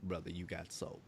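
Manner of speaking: low, soft demonstration; undertone. Shown as a demonstration. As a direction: drop it down low and quiet under the talk. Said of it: "gotta say it in an undercut".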